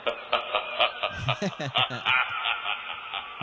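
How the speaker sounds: long, creepy put-on chuckle; drawn-out villain laugh; German laugh. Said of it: "That's a German laugh".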